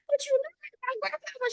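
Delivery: high, pleading squeak; high mock plea. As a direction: insist like a hurt child. Pleading to goddess in story.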